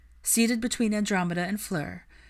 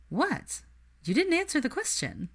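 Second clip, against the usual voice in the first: much higher, amused challenge; teasing Hermione; 10 semitones higher than usual. Acted. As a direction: needle him playfully. Narrator voices Hermione.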